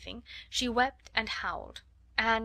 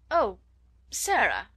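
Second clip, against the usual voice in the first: loud "Oh", pause, then the name; a caught-out exclamation, trying to sound agreeable; exclaimed; 7 dB louder, slower than usual. A narrator's reading. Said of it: "she exclaimed".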